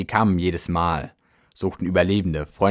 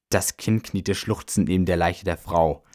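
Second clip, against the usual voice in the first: plain reading voice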